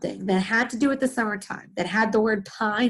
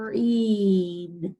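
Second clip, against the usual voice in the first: blended slowly; 3 semitones lower than usual. Shown as a demonstration. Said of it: "What is it?".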